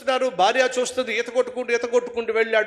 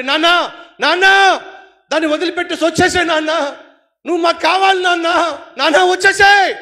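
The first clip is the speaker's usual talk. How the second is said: higher, loud pleading cries; children shouting; 7 semitones higher, 9 dB louder than usual. Acted. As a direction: call out to father in the river. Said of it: "పిల్లలు అరుస్తున్నారండి". (నాన్నను పిలుస్తూ అరిచే పిల్లలు)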